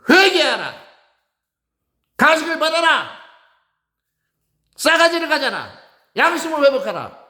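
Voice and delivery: high, loud cries of repentance; prophetic shouting. Acted. Preacher calling this wicked generation to repent, loud high shout. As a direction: cry out like a prophet in the wilderness. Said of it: "나는 외칠 것이다".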